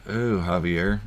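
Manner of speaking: ordinary talk, nothing marked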